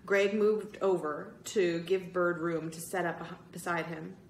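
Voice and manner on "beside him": usual speaking voice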